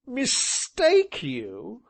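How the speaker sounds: high, very slow gasping laugh; gasped laughter. Acted. Gentleman gasping with laughter on the settle. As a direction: gasp it out laughing. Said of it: "he gasped".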